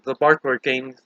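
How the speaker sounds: plain reading voice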